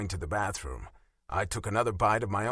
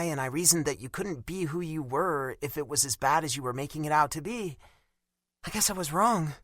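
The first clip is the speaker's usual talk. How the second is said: put-on higher, hurt young woman's voice; a wounded girlfriend, raised in pitch; Bella's voice; 7 semitones higher than usual. A narrator's reading.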